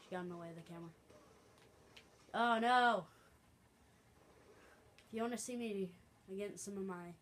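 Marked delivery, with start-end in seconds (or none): 2.3-3.1 loud, higher, drawn-out cry; dismayed shout. Real, not acted. Player reacting to a game setback, dismayed shout. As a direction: shout it as things go wrong.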